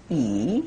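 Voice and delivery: E as a long ee with lips spread wide; long E sound. Shown as a demonstration. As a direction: hold E as a stretched ee. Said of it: "spreading the lips with a long E sound".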